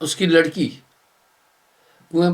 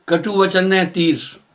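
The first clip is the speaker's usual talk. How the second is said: slow, measured recitation of a couplet; preacher reciting a doha slowly to listeners. Acted. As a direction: draw out each half-line like scripture.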